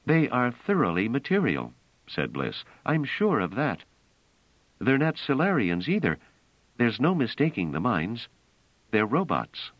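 a hint of a young woman's voice, assured; Bliss voice. A narrator's reading. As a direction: speak as Bliss, sure and composed.

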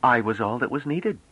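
high, proud assertion. A narrator's reading. Lone envoy answers whether she was alone, proud. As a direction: state it with pride.

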